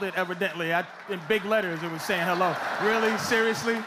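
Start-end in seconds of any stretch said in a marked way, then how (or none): none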